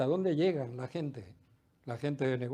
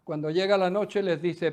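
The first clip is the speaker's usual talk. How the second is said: normal talking voice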